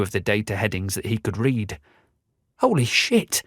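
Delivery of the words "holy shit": shocked gasp, high pitch. A narrator's reading. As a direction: blurt it out in shock. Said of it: "gasped Roger".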